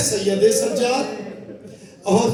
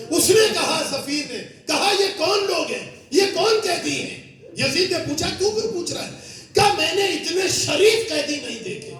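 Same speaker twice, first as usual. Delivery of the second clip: preaching at full force; 5 semitones higher than usual. Acted. Preacher telling mourners the court scene, rising to cries. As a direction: drive the tragedy home to the mourners.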